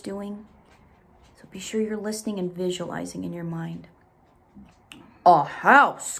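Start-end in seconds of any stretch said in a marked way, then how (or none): 5.2-6.2 loud excited bear voice; Bear crying out. Acted. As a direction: bear exclaims his dream. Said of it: "cried Bear".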